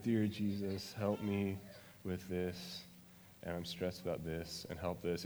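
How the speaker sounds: low-energy going-through-the-motions prayer; rote bedtime prayer. Retold or imitated. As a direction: rattle off a sleepy routine prayer. Casual bedtime prayer, mocked.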